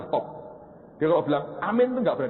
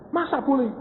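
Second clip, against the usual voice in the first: much higher, incredulous question; surprised wife. Retold or imitated. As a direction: ask it in disbelief, pitched way up. Wife's astonished question. (istri kaget, bertanya dengan nada tinggi tidak percaya)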